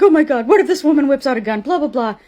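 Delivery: mocking her mother worrying during the fight; mock panicking mother. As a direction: play the mother fretting she'll get shot.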